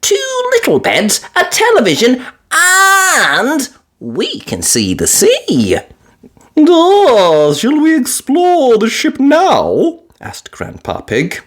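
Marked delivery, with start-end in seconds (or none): 0.0-5.8 put-on high, piglet-girl voice; bright little girl gasp; young girl's delighted ooh; Peppa voice. A narrator's reading. 6.6-10.0 higher, slow grandpa voice; lightly voiced old grandpa, slow and pleased; a hint of a kindly grandfather; Grandpa Pig voice. A narrator's reading.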